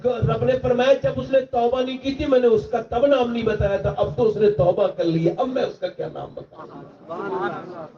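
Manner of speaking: high, impassioned pleading sermon; preacher pleading with the young men; preaching at full force. Acted. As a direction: build the crowd toward repentance. Preacher voicing God at the climax.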